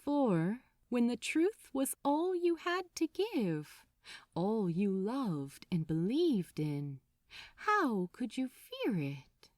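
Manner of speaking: ordinary delivery, nothing marked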